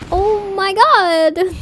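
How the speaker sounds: stunned. Real, not acted. Shocked at the sight.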